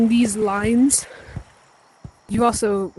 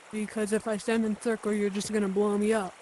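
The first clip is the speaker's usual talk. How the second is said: skeptical bystander; 9 dB quieter than usual. Acted.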